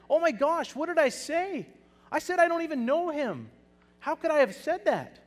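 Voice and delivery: loud, high anguished cry of regret; Peter's anguish. Acted. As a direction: cry out in horror at what you've said. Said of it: "imagine what Peter's feeling".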